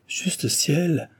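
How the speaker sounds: a touch of startled surprise, lightly voiced; mildly hammed-up astonished exclamation; surprised cry. A narrator's reading. Delville startled by Cécile's reproach, mild surprised cry. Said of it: "s'écria-t-il surpris".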